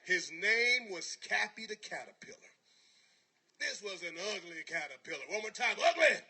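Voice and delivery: loud, high, fired-up preaching; full-force preaching. Acted. Motivational speaker preaching the caterpillar story at full force. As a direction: rouse the crowd like a revival preacher.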